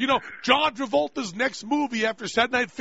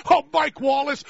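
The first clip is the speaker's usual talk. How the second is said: normal talking voice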